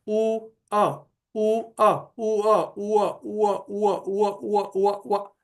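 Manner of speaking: ऊ अ repeated, blending into व; blending vowels. Shown as a demonstration. Teacher showing ऊ and अ merging into व, repeating. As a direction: run ऊ into अ until you hear व. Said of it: "ऐसे अगर हमने उसका उच्चारण किया".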